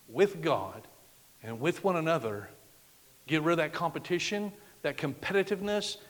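usual speaking voice

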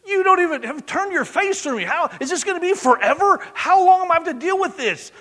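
high, loud pleading cry; anguished lament. Retold or imitated. The psalmist crying out to God, high pleading lament.

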